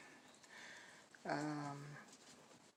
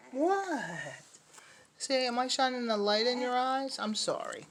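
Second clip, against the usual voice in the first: puppy talk; 9 semitones higher than usual. Real, not acted. Talking to puppies, light in eyes.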